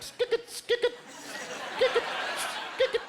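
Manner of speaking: high squeaky skik it, mimicking a rubbing squeak; squeaky condom noise. Comic imitating a cheap condom squeaking during sex, high and squeaky.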